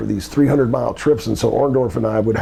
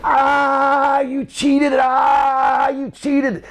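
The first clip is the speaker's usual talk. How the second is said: high, far-off anguished yell; pained shout; much slower than usual. Retold or imitated. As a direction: cry out in pain and accusation. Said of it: "all of a sudden I hear".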